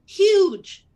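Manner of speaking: huge said high and loud; emphatic repeat. Real, not acted. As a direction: lean hard into huge.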